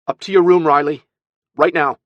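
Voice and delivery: loud, high, sharp order. Acted. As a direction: command the child upstairs at once. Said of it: "Her sharp tone clearly took him aback".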